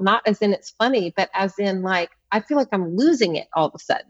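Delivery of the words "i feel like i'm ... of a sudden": rushed, fast panicky inner voice; mock frantic. Speaker voicing someone feeling hysterical, fast and rushed. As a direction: rattle it off like you're losing it. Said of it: "maybe you even feel a bit hysterical".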